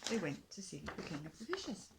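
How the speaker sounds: ordinary delivery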